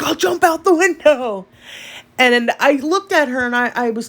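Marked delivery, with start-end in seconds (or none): 0.0-1.5 daughter exasperated. Retold or imitated. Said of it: "She's like".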